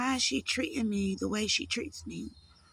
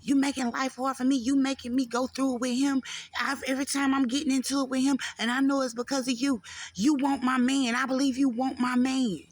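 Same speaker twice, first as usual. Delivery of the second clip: loud, higher, accusing rant in her mother's voice; retold furious mother, louder and higher; mother's angry accusation; 4 semitones higher, 5 dB louder than usual. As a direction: hurl the blame at your daughter. Mother accusing her of wanting boyfriend.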